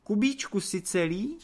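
high-pitched, anxious call; alarmed cry. A narrator's reading. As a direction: call out to check he's unhurt. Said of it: "vykřikla Anče".

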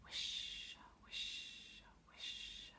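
long, hushed sibilant whisper; whispering trees. A narrator's reading. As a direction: whisper it like rustling leaves. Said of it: "heard the trees whispering".

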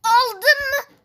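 high, loud tearful cry. Real, not acted. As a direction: cry it out anxiously. Anxious question to friend.

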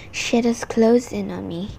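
usual speaking voice

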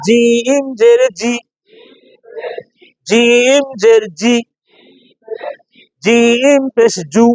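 long-held জিম, hard j on জি and জু; slow spelling drill with a hard j in জিম; firm jim. Shown as a demonstration. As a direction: stretch জিম, then strike জি and জু from mid-tongue. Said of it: "শক্ত হবে না নরম হবে".

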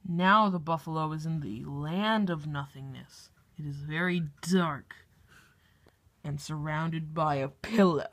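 low, calm wildlife narrator; nature documentary. Acted. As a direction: narrate like a nature show host.

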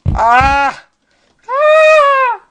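high, loud screams; panicked scream. Real, not acted. Player under attack in the game, high panicked screams.

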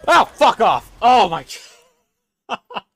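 sudden loud frightened yell; startled scream. Real, not acted. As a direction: shout in real fright. Startled by screeching enemy in game.

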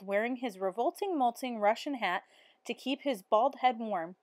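ordinary delivery, nothing marked

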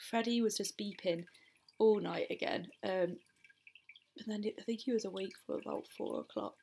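ordinary delivery

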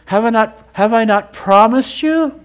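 high, loud and insistent, hammering the question; rising preacher's shout. Acted. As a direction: build it into a pulpit cry. Preacher climaxes repeating God's question.

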